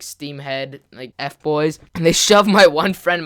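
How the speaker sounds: ordinary delivery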